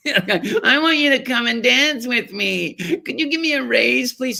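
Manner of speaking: put-on high, slow, sing-song toddler plea; whiny child voice. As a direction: ask for a raise like a whining toddler. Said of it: "imitating asking like toddler".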